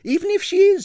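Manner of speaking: high, loud, stubborn; young dancer stubbornly refusing to back down; obstinate. A narrator's reading. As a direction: dig in your heels. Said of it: "said Kasia obstinately".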